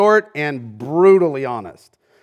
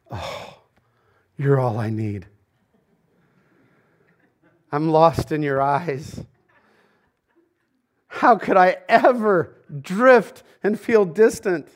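slow, dreamy sweet-nothings; mock starry-eyed groom, drawn out; lovestruck newlywed; slower than usual. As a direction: swoon over your new spouse. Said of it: "On your wedding day".

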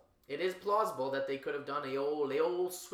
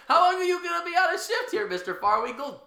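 high, fast mock questioner; 9 dB louder than usual. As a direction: rattle it off in a squeaky voice. Acting colleague questioning guard.

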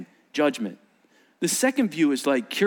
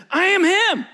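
high, loud protest; beggar insisting; 6 dB louder than usual. Acted. Healed beggar crying out to neighbors, high and loud. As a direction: plead it out over them.

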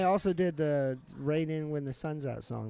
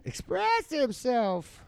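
drawn-out; 6 semitones higher, much slower than usual. Acted. Slow drawn-out intro.

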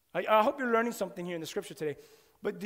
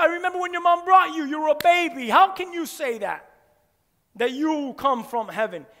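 raised, high-pitched indignant protest; upset crowd; 5 semitones higher, 6 dB louder than usual. Acted. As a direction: argue loudly in disbelief, as an offended crowd. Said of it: "They were upset with him".

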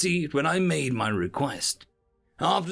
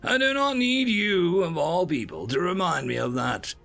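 firm rebuke starting high, then settling; sturdy; 8 semitones higher, 3 dB louder than usual. A narrator's reading. Observer putting his assistant in place, sturdy. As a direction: push back hard on the first words, then settle. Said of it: "I say sturdily".

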